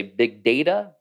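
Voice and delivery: big data as big DAY-tuh; data rhyming its first syllable with say; long-a data. Shown as a demonstration. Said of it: "I almost always say data".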